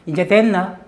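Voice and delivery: loud, high, abrupt imitation of an impatient husband; retold brusque husband. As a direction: fire it off as the unimpressed husband. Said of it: "이 남편 가서 이렇게 얘기했어요".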